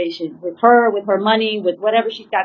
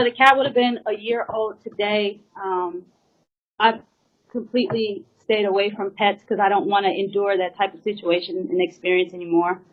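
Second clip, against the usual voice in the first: ordinary talk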